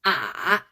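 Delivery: "Ah" dropped low with a turn upward; low scooped "Ah"; dipping third tone. Shown as a demonstration. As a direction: bend "Ah" down, then up. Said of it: "A turn".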